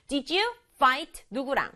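ordinary delivery

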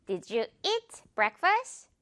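very slow, stress on "eat"; slow clear model. Shown as a demonstration. Teacher repeating the model sentence slowly. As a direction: lean on "eat", drawn out. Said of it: "이렇게 eat 길게 미소로 끌어줘야 돼요".

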